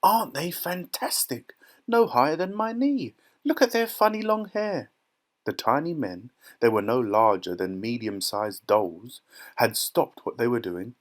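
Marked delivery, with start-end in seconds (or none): 0.0-4.9 high, thrilled squeals; amazed exclaiming. A narrator's reading. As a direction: marvel aloud like an excited onlooker. Amazed crowd exclaiming at tiny men.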